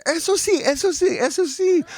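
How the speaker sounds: high, eager repeated exclamation; excited confirmation. Retold or imitated. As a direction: imitate the student delighted at the right guess. Student excitedly confirms the guess.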